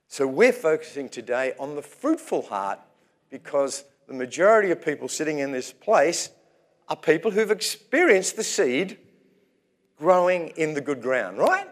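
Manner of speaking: usual speaking voice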